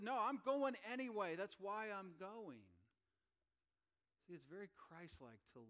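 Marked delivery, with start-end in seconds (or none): 0.0-2.6 resolute declaration. Pretend. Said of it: "He said".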